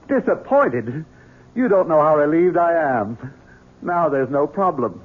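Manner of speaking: ordinary talk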